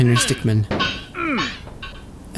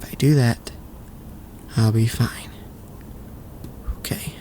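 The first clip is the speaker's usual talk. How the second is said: slow whispered self-reassurance; calming whisper; 6 dB quieter, slower than usual. Real, not acted. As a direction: whisper yourself back to calm, slowly. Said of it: "stay calm and cool".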